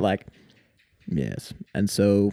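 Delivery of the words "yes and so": low, soft, dry British deadpan; dull monotone British. Retold or imitated. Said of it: "dull, monotone British voice".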